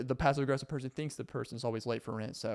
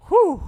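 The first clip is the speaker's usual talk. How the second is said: big loud relieved exhale; loud high whew; 13 dB louder than usual. Acted. After the rant.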